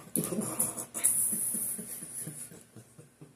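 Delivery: long, trailing laugh; drawn-out high giggle; real laughter. Real, not acted. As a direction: laugh it out, fading off. Laughs after "I'm just saying.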